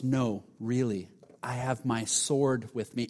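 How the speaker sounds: low, slow, softly spoken threat; hushed menace, imitated. Vampire-group man insisting to Tim, imitated quiet menace.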